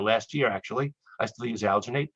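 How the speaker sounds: usual speaking voice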